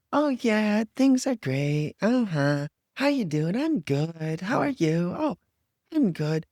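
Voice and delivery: high, overbright fake cheer; fake exuberance. Mocking the fake-positive friend, overbright cheer. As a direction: gush like everything is wonderful. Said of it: "this overwhelming exuberance of".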